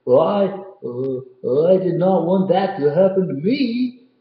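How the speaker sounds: a frightened tall man crying out his news; storyteller voicing a scared Tall Tom; Tall Tom's voice. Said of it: "he cried".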